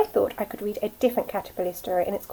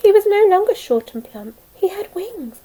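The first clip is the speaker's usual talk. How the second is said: high, loud joyful wonder; excited; 7 semitones higher, 7 dB louder than usual. A narrator's reading. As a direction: burst out with wonder. Joyful transformation.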